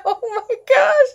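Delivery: high laughing gasp. Real, not acted. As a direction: laugh through the words. Laughing reaction to the story.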